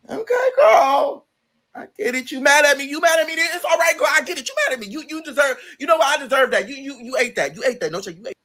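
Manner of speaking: high, sheepish give-in voice; conceding. Retold or imitated. Giving in to her angry friend, high sheepish concession.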